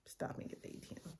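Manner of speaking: fast whispered warning; whispered aside. Real, not acted. Vlogger warns viewers in a fast whisper. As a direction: hiss it quickly to the camera.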